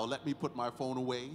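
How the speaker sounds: ordinary delivery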